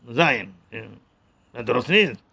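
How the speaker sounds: normal talking voice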